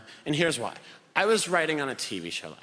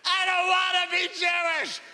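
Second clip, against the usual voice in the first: put-on crying child wail. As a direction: wail it like a sobbing kid. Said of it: "I start crying hysterically and I went".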